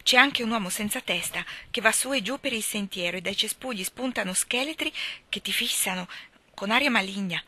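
usual speaking voice

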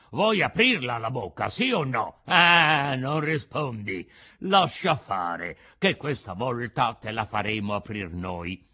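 loud, rough menace, slowing down; bully the puppet into opening up; threatening assassins. A narrator's reading. (assassini minacciosi che intimidiscono il burattino)

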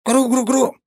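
very high, loud squeaky wail; a tiny sprite raising a racket; wailing creature cry. A narrator's reading. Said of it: "заголосил древень". (громкий высокий вопль маленького древня)

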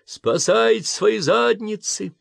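high, slow, loud yell. Acted. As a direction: bellow it at the crew. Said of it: "заорал он".

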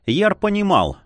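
ordinary delivery

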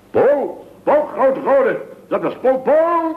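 high alarmed calls of Paul; calling out. Acted. Boss calling out in alarm after his assistant Paul.

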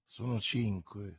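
low and hushed, close to a friend. A narrator's reading. Athos warning his comrades, softly hushed. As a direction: murmur so the enemy can't hear. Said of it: "sussurrò Athos".

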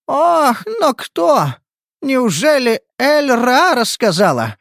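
high, alarmed cry; shocked exclamation. Acted. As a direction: gasp in disbelief. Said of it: "воскликнула Тайха".

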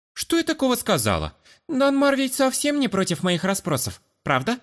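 put-on high, coquettish girlish voice.

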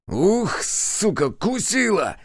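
angry pained hiss. A narrator's reading. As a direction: bitten policeman swearing. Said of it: "зашипел полицай".

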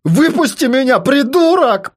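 high, urgent demand to be let out; heated yell at the captor; angry demand. Acted. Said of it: "потребовал Рома".

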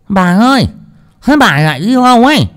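high, loud call from the well; lisping cook calling. Acted. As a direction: call after the mistress. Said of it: "giọng ngọng líu ngọng lô".

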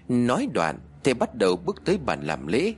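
ordinary delivery, nothing marked

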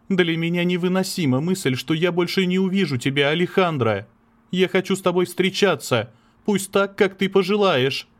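lightly acted painful parting; voiced breakup talk. (напряжённый разговор о расставании)

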